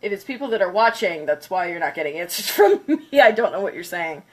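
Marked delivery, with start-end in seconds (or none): none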